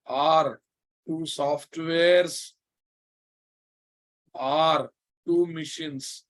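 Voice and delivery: slow with long pauses; slow dictation. Shown as a demonstration. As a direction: spell out the definition slowly. Teacher dictating a definition.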